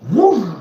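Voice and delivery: high, loud imitated whoosh of the engine catching; loud whoosh sound. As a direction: burst out with a loud whoosh as it fires.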